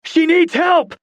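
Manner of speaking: very high, loud shout. Acted. Dawson shouts at the crowd for help. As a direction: order the crowd, frantic. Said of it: "Dawson shouted at them".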